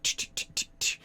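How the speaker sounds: soft, voiceless rapid "ch" clicks; imitated switch-flicking. Game master as pilot flicking on buttons, soft clicking sound effect. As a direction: make little button noises with the mouth. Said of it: "flicking on buttons".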